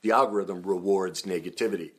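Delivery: usual speaking voice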